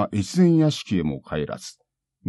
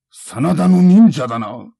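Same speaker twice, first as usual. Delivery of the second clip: loud, higher angry cry; loud accusation; 6 semitones higher, 9 dB louder than usual. Acted. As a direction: shout the recognition. Samurai recognizes ninja.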